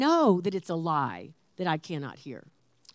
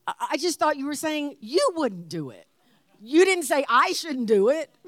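higher-pitched, mock-offended arguing; indignant protest; 5 semitones higher than usual. Wife dismissing husband's fleeting mention, indignant. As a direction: act out a wife's indignant rebuttal.

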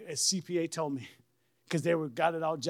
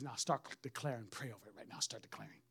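quiet command; 10 semitones lower, 13 dB quieter than usual. Retold or imitated. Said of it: "the Lord says".

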